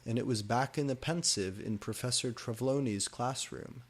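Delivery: ordinary delivery, nothing marked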